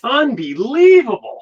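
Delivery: slow, high exasperated unbelievable; drawn-out disbelief. Acted. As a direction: stretch out the word in shock. Shocked after Earl leaves.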